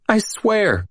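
high, tearful plea; weeping. A narrator's reading. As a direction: swear it while crying. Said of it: "Green wept".

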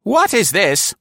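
put-on high, loud, startled old woman; sudden high elderly cry; startled old lady. A narrator's reading. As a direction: she just stumbled on something. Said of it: "gasped sharply".

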